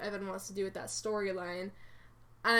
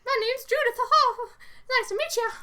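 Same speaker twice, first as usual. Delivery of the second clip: very high, loud cartoon mouse squeak; Mickey Mouse voice; 8 dB louder than usual. Shown as a demonstration. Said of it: "Mickey Mouse voice".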